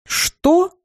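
high-pitched roaring outburst. A narrator's reading. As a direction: bellow it out in disbelief. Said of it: "снова взревел профессор Радомир".

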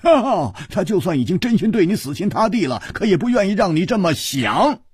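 triumphant booming hero; loud exclaiming. Acted. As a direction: slap the table and announce it to everyone. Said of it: "大声说".

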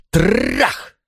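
high rolled-r crash, rising; crashing blow. A narrator's reading. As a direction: voice the decisive bang on a door. Narrator imitates blows on door.

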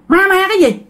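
high-pitched, indignant retort; arguing back. Acted. Grandpa scoffing at grandma's talk of ghosts, arguing back. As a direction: throw it back, pitched well up. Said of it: "cái ông ngoại mới cãi".

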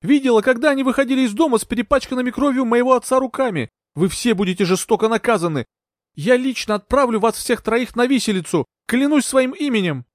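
sustained high-pitched fury; high, impassioned accusing outburst; screamed tirade. A narrator's reading. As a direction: rage on at high pitch, vowing revenge. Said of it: "закричала Маргарет".

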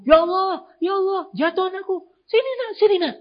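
very high-pitched mock wail; panicking parent. Parent seeing the child fall, mock panic in high voice. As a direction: imitate an overreacting, alarmed parent. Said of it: "malah memperbesar masalah".

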